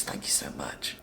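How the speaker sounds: breathy whispered aside; hushed secret whisper. Acted.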